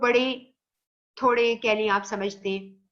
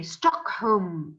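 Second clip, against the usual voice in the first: British accent; 4 semitones lower, much slower than usual. Shown as a demonstration. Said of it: "sound उनकी गूंजती है".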